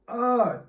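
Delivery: mock high pained gasp; pained grunt. Stabbed man gasps again as hit, high grunt. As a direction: another short high grunt of pain. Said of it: "Hit him".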